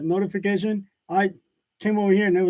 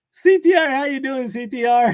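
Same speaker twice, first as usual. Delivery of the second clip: calling out; 6 semitones higher, 5 dB louder than usual. Real, not acted. Greeting a viewer on livestream.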